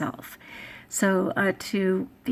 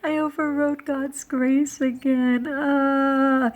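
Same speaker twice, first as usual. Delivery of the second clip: mock confession; 5 semitones higher, 4 dB louder, slower than usual.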